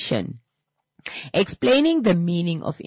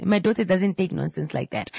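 ordinary talk

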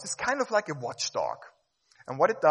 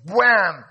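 slam sound; 5 semitones higher, 14 dB louder than usual. Retold or imitated. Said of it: "puts up a big folder ... and goes".

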